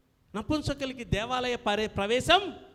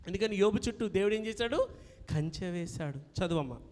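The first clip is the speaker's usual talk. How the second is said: usual speaking voice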